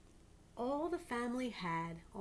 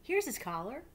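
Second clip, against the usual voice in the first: put-on higher, louder eager boy; proud boy; 6 semitones higher, 5 dB louder than usual. Boy giving his belt as a collar, eager. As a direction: offer it proudly.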